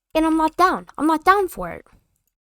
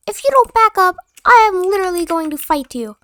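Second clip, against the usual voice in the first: put-on higher and louder, squeaky tough-guy kid; mock kid threat; 7 semitones higher, 4 dB louder than usual. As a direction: play a little kid acting tough.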